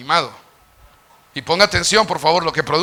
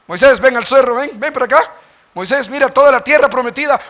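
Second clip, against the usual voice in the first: God calling Moses; 7 semitones higher, 5 dB louder than usual. Acted.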